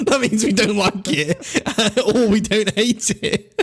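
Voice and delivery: slow, giggling through words; laughing. Real, not acted. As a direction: explain it while laughing.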